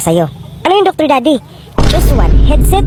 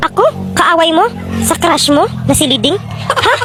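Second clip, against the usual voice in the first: usual speaking voice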